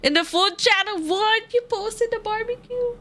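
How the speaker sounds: high-pitched, astonished outburst; excited disbelief. Real, not acted. Streamer spotting a barbecue post in the food channel, astonished. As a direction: squeal it in surprise.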